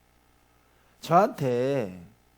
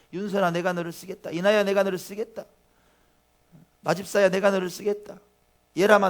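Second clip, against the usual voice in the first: growing higher and louder with each name; building calls of the Lord; 6 semitones higher, 7 dB louder than usual. Acted. Pastor voices the Lord calling members, building louder. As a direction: call out each member, rising in fervor. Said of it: "주님 말씀하십니다".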